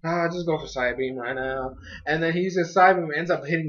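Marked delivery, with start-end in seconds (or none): 0.0-1.8 careless order. Retold or imitated. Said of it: "he doesn't even care".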